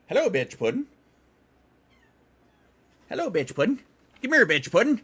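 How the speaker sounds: high cooing call to a cat; calling a pet. Real, not acted. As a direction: coax the cat over in a high, loud singsong. Calling her cat over.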